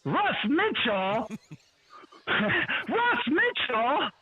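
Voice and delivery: lightly voiced call-out, drawn out and laughing; booming voice. Retelling Marcus Allen calling his name, mock booming. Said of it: "this booming voice say".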